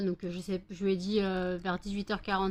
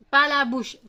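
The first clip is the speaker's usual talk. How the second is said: stern no; 5 semitones higher, 9 dB louder than usual. Real, not acted. Scolding dogs licking her face.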